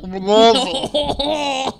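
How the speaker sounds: Louisville very slow, laughing, mouth full; mushy Louisville. Shown as a demonstration. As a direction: stretch Louisville out through a mouthful. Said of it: "Like a dick in your mouth".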